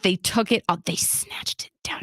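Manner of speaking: furious whisper, imitating mom; seething anger. Mom rages to her daughter that it was taken, seething whisper. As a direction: hiss it in a voiceless, angry whisper. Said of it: "she's so fucking angry".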